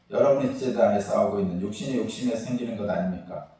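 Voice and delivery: loud, solemn Bible reading; scripture reading. Acted. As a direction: proclaim the passage to the congregation. Reads scripture aloud. (성경 봉독하듯 낭독)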